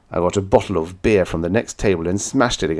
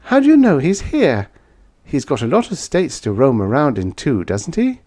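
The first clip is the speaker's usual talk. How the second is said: much higher, doubting woman's voice; sceptical woman; 7 semitones higher, 4 dB louder than usual. Acted. Woman doubts he knows where the man is, higher and sceptical. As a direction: press him with disbelief.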